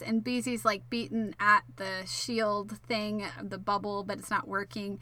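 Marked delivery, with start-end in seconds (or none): none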